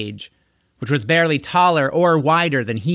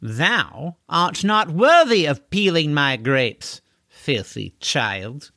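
slow, haughty contempt, sinking at the end; sneering; 3 semitones higher, slower than usual. Acted. Horseman to caged child, sneering. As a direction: look down on him and draw out the insult. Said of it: "sneering at him".

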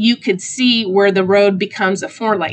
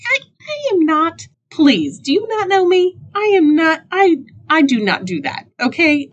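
high-pitched mock-offended protest; indignant protest; 9 semitones higher than usual. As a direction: protest in an affronted, higher voice. Mock protest at being called Karen.

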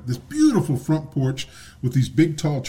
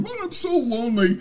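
high, pitiful put-on cat voice; mock-pitiful cat voice; lonely cartoon cat; 11 semitones higher than usual. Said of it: "A cat sitting there going".

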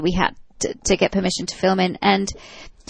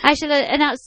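Irish priest; 6 semitones higher, faster than usual. Retold or imitated. Priest wishes film crew well, high imitated Irish accent. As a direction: bless them in a put-on Irish accent. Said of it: "forgive my terrible Irish accent".

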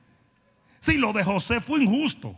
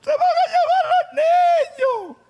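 much higher, louder hammed-up wail; mock wailing woman; 5 dB louder than usual. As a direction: wail like a frantic mother. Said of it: "Viene la mujer llorando". (imitación exagerada de una mujer llorando a gritos)

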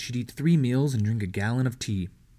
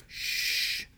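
whispered warning hush; warning shush; 4 dB quieter than usual. A narrator's reading. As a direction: hush them sharply under your breath. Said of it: "warned Mary".

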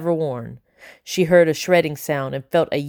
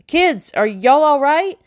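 breathless call; 10 semitones higher, 5 dB louder, slower than usual. Acted. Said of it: "called a breathless voice".

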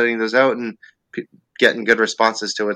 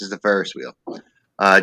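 normal talking voice

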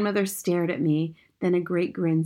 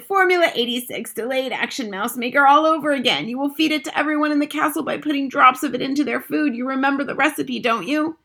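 loud, high, rousing instructions; excited shouting; 8 semitones higher, 5 dB louder than usual. A narrator's reading. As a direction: shout out the plan eagerly. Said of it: "my grandmother shouted".

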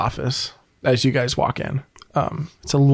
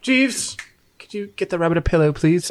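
pitched-up call, then a quick request; 5 semitones higher than usual. Acted. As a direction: boss calling the butler in a put-on accent.

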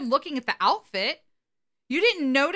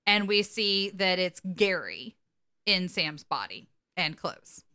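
ordinary talk

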